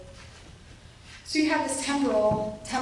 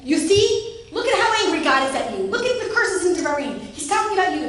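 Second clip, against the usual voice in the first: accusing; 6 semitones higher, 6 dB louder than usual. Retold or imitated. Quoted condemnation of kin.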